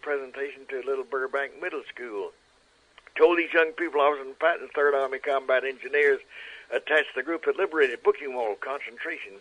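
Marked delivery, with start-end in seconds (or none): none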